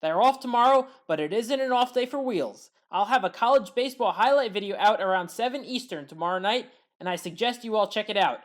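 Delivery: ordinary delivery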